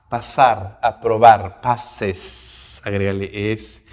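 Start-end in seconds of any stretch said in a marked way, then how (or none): 1.6-2.8 "passes" in two distinct syllables, the added E fully sounded; clear ES ending. Shown as a demonstration. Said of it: "la E que suene fuerte y claro".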